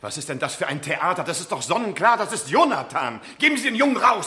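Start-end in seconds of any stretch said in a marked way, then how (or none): 3.4-4.3 higher, louder demand; raised, indignant demand; angry demand. Acted. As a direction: insist on getting the boy back. Father demands his son.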